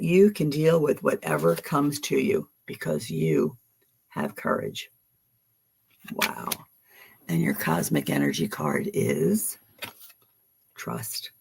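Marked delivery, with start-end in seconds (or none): none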